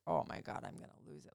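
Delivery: breathless near-silent whisper, fighting tears; choked-up whisper. Retold or imitated. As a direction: barely get it out before crying. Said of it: "I literally started crying".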